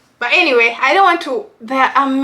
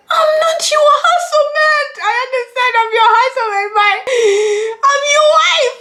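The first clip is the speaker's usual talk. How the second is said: high, loud fake crying protest; mock wailing wife; 6 dB louder than usual. Pretend wife complaining to husband, mock crying. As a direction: wail it like a wounded wife. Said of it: "And be like".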